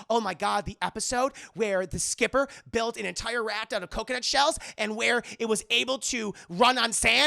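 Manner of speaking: breathlessly thrilled fellow fan, acted; excited fan gushing. Librarian thrilled by shared fandom.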